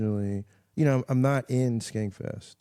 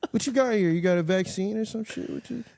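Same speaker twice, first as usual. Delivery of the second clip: high mock indignation at free chicken; suspicious outrage; 10 semitones higher, 3 dB louder than usual. Guest mocking himself at Costco samples, high outraged squawk. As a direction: squawk at the sample like it's a trap. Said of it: "I'm like".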